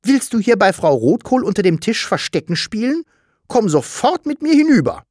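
cross, rising into a sharp command; annoyed scolding. A narrator's reading. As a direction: scold the child and order it along. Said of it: "wirklich ärgerlich".

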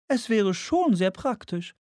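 hohe, langsame Frauenstimme; Frauenfigur. Acted. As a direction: zieh es hoch und langsam als Frau. Woman voiced in narration. (hohe, gedehnte Frauenstimme)

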